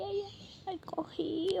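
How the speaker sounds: usual speaking voice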